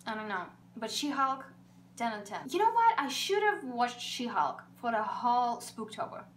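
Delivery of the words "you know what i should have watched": sudden high burst; excited. Real, not acted. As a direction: blurt it out with glee.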